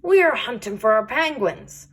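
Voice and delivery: plain reading voice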